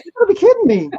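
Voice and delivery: high, loud incredulous outburst; mock disbelief. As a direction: protest in exaggerated disbelief, voice shooting up. Acts out his exasperated reply.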